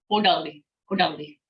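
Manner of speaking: "Hodelli" said as one whole word; brisk whole-word "Hodelli", repeated; not split up. Shown as a demonstration. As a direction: keep "Hodelli" joined, no syllable breaks. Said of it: "we're not gonna split up".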